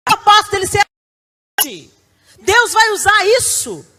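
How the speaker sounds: high, loud preaching cry; preaching shout. Real, not acted. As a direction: proclaim it at full force. Preaching climax. (grito de pregação)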